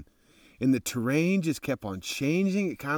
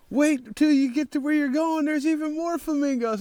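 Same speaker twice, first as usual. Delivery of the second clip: excited hype; 14 semitones higher than usual. Retold or imitated. Strangers hyping flamingos ahead.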